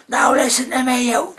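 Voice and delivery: very high, loud shout; raging neighbour. Acted. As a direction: launch into an angry tirade. Neighbour starts tirade at door.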